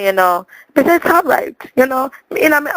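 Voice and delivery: ordinary talk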